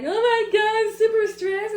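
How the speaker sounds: high, loud stressed outburst; mock stressed wail. Retelling her own crying fits, a high loud stressed outburst. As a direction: let the overwhelm burst out. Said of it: "I cry a lot 'cause I'm like".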